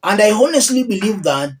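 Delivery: usual speaking voice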